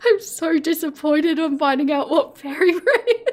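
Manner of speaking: real laughter bubbling through the words; giggling. Real, not acted. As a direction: read it out while fighting the giggles. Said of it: "I'm gonna get the giggles now".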